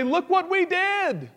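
high, slow mock bragging; hammed-up proud boast to God; boastful demand. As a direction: crow at God about your deeds. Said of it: "had the audacity to stand before God and say".

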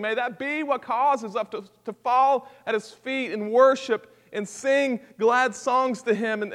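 ordinary talk, nothing marked

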